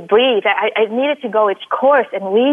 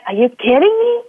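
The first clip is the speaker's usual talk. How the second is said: incredulous; 6 semitones higher than usual. Retold or imitated. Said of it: "And I'm like".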